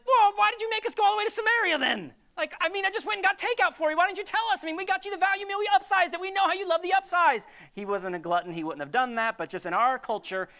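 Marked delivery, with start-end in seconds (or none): 0.1-7.5 high, excited buddy bringing takeout; hammed-up eager friend, pitched up; mock disciple voice. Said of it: "if there are guys like us, be like".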